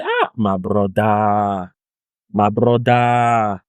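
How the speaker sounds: long, slow drawn-out vowels; imitating rueful friend. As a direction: stretch it out like a weary lament. Imitates friend's rueful answer about grades.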